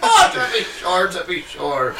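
cheesy mock Irish accent. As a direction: drawl the stock Irish phrase with a laugh. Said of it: "I went full on leprechaun at".

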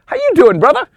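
loud, high, hearty greeting; O J, all smiles. Retold or imitated. Greeting the seething golfer, loud and all smiles. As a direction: boom a cheery hello. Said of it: "O J goes from all smiles".

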